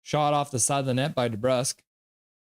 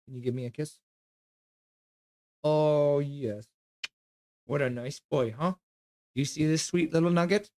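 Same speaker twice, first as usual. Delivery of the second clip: cooing at the dog. Real, not acted.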